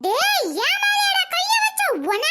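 high, slow shocked outcry; outraged shock. Acted. Mother shocked that son hit her, high. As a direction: recoil in outrage.

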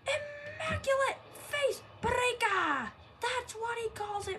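slow, high, loud move call; wrestling commentator. Acted. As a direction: stretch out the finisher name. Commentator calls finisher.

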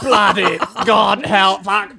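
slow, hammed-up British curse; mock British. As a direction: drawl it like a stiff-upper-lip Brit. Mocking British soldier exclamation.

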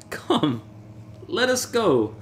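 put-on high, pushy drunken urging; eager Fortunato. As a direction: hurry him along.

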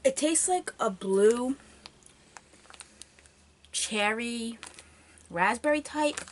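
talking while chewing candy. Real, not acted. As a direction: mouth partly full. Seen 0: Lips pressed and jaw working between words, shortly after eating from bag.

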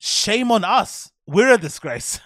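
slow, high, loud mock lawyer; imitating the lawyer. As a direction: ham up the lawyer's solemn line.

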